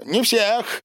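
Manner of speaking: high, gleeful boasting; excited gushing. A narrator's reading. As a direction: gush like an overexcited child. Boris raving about his beloved dinosaurs. (восторженно, высоким голосом)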